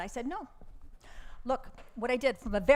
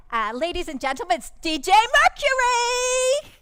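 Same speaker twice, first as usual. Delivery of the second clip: loud, high, drawn-out MC intro; stage announcement; 11 semitones higher, 9 dB louder than usual. Acted. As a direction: build up the name like a ring announcer. Manager announcing DJ to audience.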